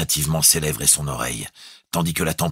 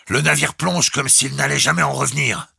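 high, raised, irritated call; 11 semitones higher, 4 dB louder than usual. A narrator's reading. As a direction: complain irritably down the speaking tube. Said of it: "Puis, avec irritation". (irrité, à voix haute)

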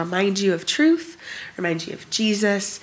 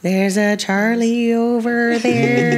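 teasing sing-song; 3 semitones higher, 4 dB louder, much slower than usual. Pretend. Teasing about Charlie.

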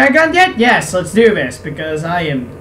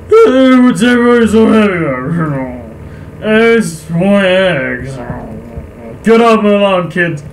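put-on grumpy old man. Old villager yelling kids off his lawn, grumpy voice. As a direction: holler like an old crank at the kids.

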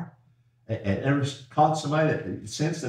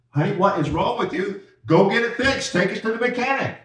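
higher, louder scolding, rising in pitch; exasperated wife; 9 semitones higher, 5 dB louder than usual. Retold or imitated. A hint of a wife scolding her stalling husband, higher and louder. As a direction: play the fed-up wife sending him off.

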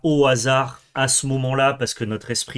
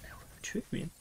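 whispered aside; 6 semitones higher, 16 dB quieter than usual. Real, not acted. Plotting aloud very softly.

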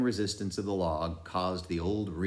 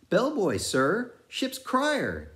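high, sing-song crier voice; mad bellboy crier; 4 dB louder than usual. A narrator's reading. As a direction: ring out like a mad ship's crier. Pip's mad bellboy chant.

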